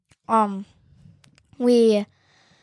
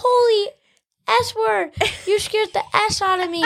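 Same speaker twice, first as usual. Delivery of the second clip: mimicked startled outburst; 9 semitones higher than usual. Voicing the girl just scared.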